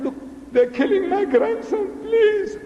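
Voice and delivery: high, strained mock plea for help; acted desperate begging; pleading. Acting the Prophet begging Allah.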